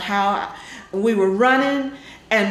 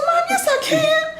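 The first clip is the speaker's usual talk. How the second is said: laughing insistence. Retold or imitated. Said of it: "I said".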